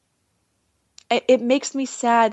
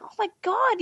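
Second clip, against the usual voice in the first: amazed; 11 semitones higher than usual. Retold or imitated. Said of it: "I'm like".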